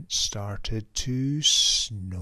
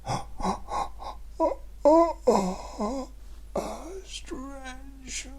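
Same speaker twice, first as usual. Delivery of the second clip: high strained stretch groan; yawn into stretch; 14 semitones higher than usual. Shown as a demonstration. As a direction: groan the word out mid-stretch. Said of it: "a yawn, a stretch".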